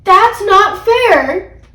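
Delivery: loud, high, drawn-out protest; indignant children's wail; crying out. A narrator's reading. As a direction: cry it out slowly as upset kids. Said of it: "Peter and I cried".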